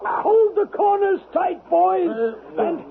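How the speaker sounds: shouting. Acted. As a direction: call it out as he flies up. Heaving him up in blanket.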